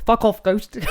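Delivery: high, laughing Korg imitation. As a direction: sweetly tell a ghost off. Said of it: "He's just like, he's like".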